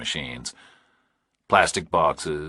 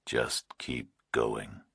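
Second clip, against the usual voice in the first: slow, low, menacing whisper; distorted nightmare voice; 7 semitones lower, 10 dB quieter, much slower than usual. A narrator's reading. Kidnapper on the phone to Burke, slow eerie whisper. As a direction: breathe it like a monster on the line.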